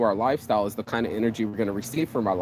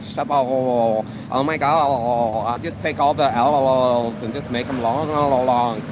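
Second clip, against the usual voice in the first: mocking a clueless heiress; 4 dB louder, slower than usual. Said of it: "You're like".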